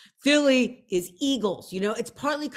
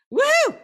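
excited whoop; 8 dB louder than usual. Real, not acted. Swooning over the guide.

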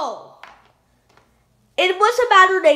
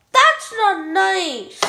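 ordinary talk

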